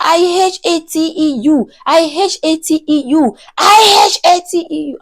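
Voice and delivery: high-pitched mock scream, held back in volume; imitated screaming. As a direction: chant it like her repeated screaming. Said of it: "So she was just screaming that".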